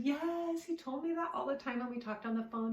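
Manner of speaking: retelling grandpa's excited high yes, then settling down; a grandfather suddenly lit up with recognition, imitated; eager yes. Grandfather confirms again eagerly.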